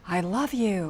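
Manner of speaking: soft, lingering "I love you"; tender. Shown as a demonstration. Wife showing husband the loving way to say it. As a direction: say it like you mean it, gently. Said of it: "It wasn't like".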